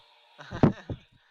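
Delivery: sudden loud burst of laughter. Real, not acted. Amused by game comparison.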